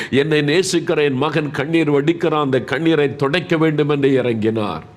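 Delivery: high, sustained preacher's cry; impassioned preaching. Real, not acted. Preacher telling of God's pity for the suffering, impassioned preaching. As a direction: pour it out with mounting compassion.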